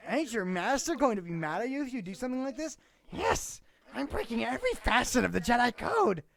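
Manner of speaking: high, loud hammed-up glee; excited Ahsoka voice. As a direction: squeal it out gleefully. Acting Ahsoka's dialogue.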